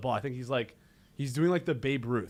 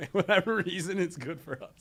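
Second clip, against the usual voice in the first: laughing repeat; 4 semitones higher than usual. Real, not acted. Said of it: "repeats it laughing".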